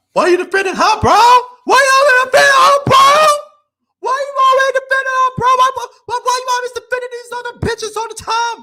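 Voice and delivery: put-on high, shrill whining girlfriend voice. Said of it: "that's what she's saying to [name]".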